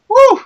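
loud, high "woo"; emphatic whoop. Retold or imitated. Recalling dad's beating at grandmama's house, loud whoop. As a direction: let out a whoop at the memory.

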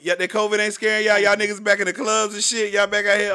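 loud, high mock official voice; mocking the CDC. To listeners, the CDC taunting them, loud and mocking. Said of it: "They say".

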